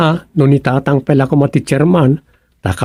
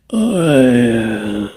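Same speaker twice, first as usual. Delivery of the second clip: despairing sigh; 4 semitones lower, 3 dB quieter than usual. Acted. Sighing in despair alone.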